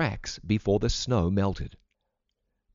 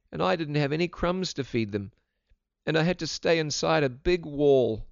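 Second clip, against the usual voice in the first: put-on higher-pitched, plaintive boy; runaway boy; 6 semitones higher than usual. A narrator's reading. Boy explains why he fled the orphanage, high and plaintive. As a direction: pour out troubles in a raised child's pitch.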